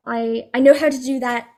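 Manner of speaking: ordinary delivery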